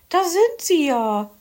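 high, loud society-lady voice; Frau Elster's greeting. A narrator's reading. Frau Elster welcomes Herr Fuchs, high affected greeting. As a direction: greet him like a fussy magpie lady. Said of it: "begrüßte ihn Frau Elster".

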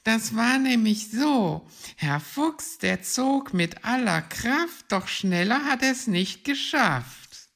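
high, loud character voice reciting verse; excited high-voiced little reciter; rhyming storyteller figure. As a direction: declaim the rhyme high and loud. Hoppel recites rhymes in the story.

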